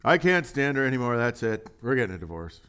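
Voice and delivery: sarcastic. Retold or imitated. Preacher mocking a husband who quits his marriage, sarcastic. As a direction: sneer out the divorce line. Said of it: "Did I say that with enough, um, sarcasm?".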